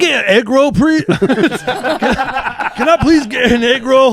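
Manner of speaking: high, loud mock Scottish-Chinese accent, laughing through it; hammed-up mixed accent ordering an egg roll. Joking with friends on a podcast, a loud mock Scottish-Chinese accent. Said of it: "then I'll do a Scottish Chinese guy".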